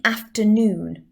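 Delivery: "afternoon" carefully sounded, syllable by syllable; emphasis on afternoon. Shown as a demonstration. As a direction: lean on "afternoon". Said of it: "a word I emphasize".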